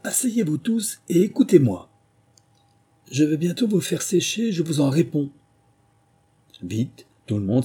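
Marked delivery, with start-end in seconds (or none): 0.0-5.3 high commanding call to the crowd; mouse calling out. A narrator's reading. Mouse calling the soaked animals to sit, high and commanding. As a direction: call them all to order. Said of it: "leur cria".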